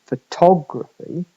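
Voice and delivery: "photography" with a strong second syllable, fuh-TOG-ruh-fee; stressed O. Shown as a demonstration. Lecturer showing the stressed vowel, second-syllable stress on "photography". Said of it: "the o vowel is stressed".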